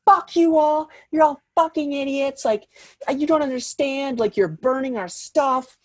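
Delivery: high-pitched mock rant; hammed-up angry squawking; demanding. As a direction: imitate the friend berating a whole crowd. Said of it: "She's demanding they put the fire out".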